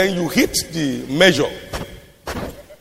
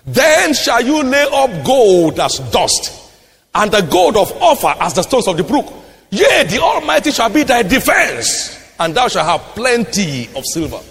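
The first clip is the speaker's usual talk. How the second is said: high, ringing scripture declamation; raised-pitch pulpit proclamation; forceful preaching; 6 semitones higher than usual. Real, not acted. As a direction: proclaim the verse with rising force.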